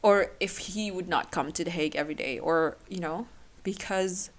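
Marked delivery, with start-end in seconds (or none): none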